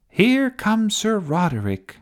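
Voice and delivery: high-pitched announcer's opening; TV commentary. A narrator's reading. Sophie describing the fight for TV viewers, commentary. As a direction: announce the knight's charge to viewers. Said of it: "doing the commentary for T V".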